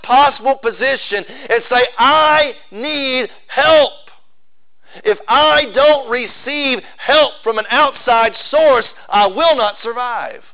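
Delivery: high, drawn-out, emphatic sermon delivery; fervent preaching. Acted. Preacher urging the congregation to admit they need help, fervent preaching. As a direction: preach it with urgency, leaning on the key words.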